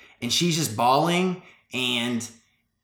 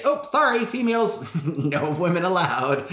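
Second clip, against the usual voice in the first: high-pitched fake apology, breaking into a laugh; hammed-up "sorry, not allowed"; mock refusal; 5 semitones higher than usual. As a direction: jokingly turn someone away.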